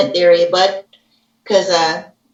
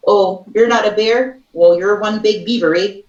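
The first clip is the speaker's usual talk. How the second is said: mock Canadian cartoon accent; goofy put-on Canadian voice; Brother Bear moose. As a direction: play a cartoon Canadian, ending on "eh". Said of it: "the Bears from Brother Bear".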